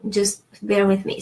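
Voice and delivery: usual speaking voice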